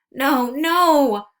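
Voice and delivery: high, loud pleading cry; desperate begging. Acted. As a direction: beg them to stop, crying out. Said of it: "I begged".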